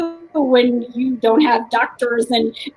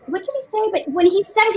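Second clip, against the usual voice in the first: ordinary talk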